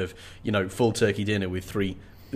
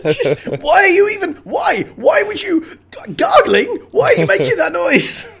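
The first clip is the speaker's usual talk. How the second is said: much higher, louder, laughing shout; real laughing disbelief, half shouted; laughing exasperation; 7 dB louder than usual. Real, not acted. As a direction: shout the question through laughter. Incredulous at the gargling girl.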